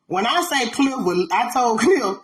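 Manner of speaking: normal talking voice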